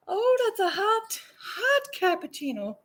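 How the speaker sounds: high squeaky yelp at the heat; surprised squeal. Real, not acted. Vlogger burned by hot cappuccino, squealing high. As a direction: squeal as the hot cup bites.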